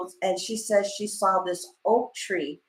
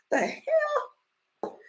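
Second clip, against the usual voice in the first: laughing disbelief; much slower than usual. Real, not acted. Reacting with cry-laugh.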